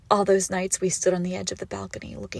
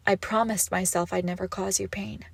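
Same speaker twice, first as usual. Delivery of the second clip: ordinary talk, nothing marked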